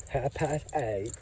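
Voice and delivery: soft, slow imitation of the woman; imitating her. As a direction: mimic her words quietly and slowly. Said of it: "that's exactly how she said".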